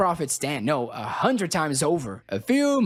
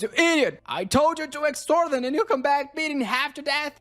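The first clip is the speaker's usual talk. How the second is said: much higher, shouting furious boss; explosive rage; 10 semitones higher than usual. Acted. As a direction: blow up at the useless knight. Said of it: "Baron Lacona explodes".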